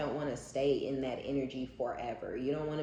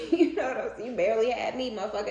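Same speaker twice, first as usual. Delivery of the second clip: higher, louder, laughing taunt; laughing petty retort; 6 semitones higher, 5 dB louder than usual. Real, not acted. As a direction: laugh through the comeback.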